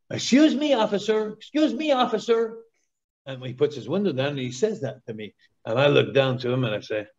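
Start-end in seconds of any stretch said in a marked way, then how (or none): 0.1-2.6 squeaky, much higher, polite calling out; high-pitched tourist voice. Retold or imitated. Tourist in his car calls up to the Mountie, high and squeaky. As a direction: pipe up high like a nervous tourist. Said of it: "And then he says".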